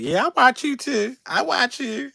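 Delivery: high, light fan voice; voicing a fan. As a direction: float it up like a sweet older fan. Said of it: "came up to me and was like".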